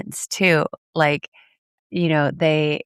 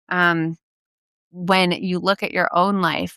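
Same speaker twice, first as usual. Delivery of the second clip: usual speaking voice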